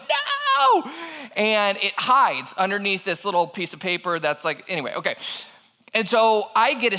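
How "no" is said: very high, drawn-out yelp; retold horror in a high falsetto wail; mock panicked cry. Homeowner as mouse runs toward front door, mock panicked cry.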